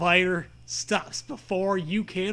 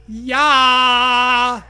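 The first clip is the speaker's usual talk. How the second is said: loud shouted yeah; cheer; 5 semitones higher, 11 dB louder than usual. Acted. Acted cheer.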